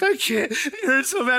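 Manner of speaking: high, mock hyperventilating sobs; hammed-up tearful whimper; imitated crying. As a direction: act out a boy crying too hard to speak. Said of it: "it's the type of crying".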